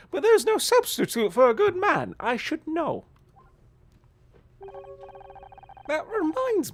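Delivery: put-on high, loud scolding old mother; shrill disapproving mom voice; old lady voice. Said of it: "old lady voice".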